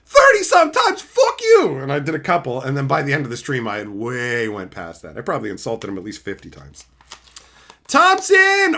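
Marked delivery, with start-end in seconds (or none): 0.1-1.8 loud, high mock outrage; hammed-up retold yell; retold outburst. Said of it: "And I'm like". 7.9-8.8 calling out. Real, not acted. Calling out a super chat.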